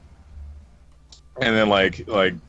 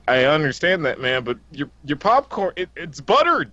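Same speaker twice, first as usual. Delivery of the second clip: building from normal to much higher, exasperated; exasperated pleading; 11 semitones higher than usual. Retold or imitated. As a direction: climb steadily higher as the disbelief grows. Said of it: "He's like".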